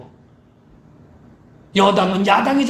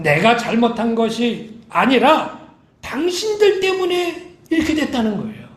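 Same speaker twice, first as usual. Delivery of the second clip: ordinary delivery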